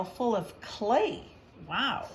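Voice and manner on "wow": plain reading voice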